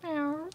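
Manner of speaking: high soft cat meow; playful meow. Acted. Cat lady date arriving, high soft meow. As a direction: mew like a coy kitten.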